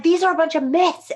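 high, loud indignant retort. Retold or imitated. Telling off the petition woman, indignant. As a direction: snap back at the myths.

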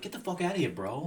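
Long Island bro attitude; dismissive Long Island bro; Long Island accent. Pretend. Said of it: "Over in Long Island".

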